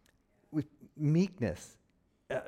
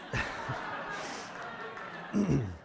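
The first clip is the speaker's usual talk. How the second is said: stifled laugh; 5 dB quieter than usual. Real, not acted. Laughing at own week.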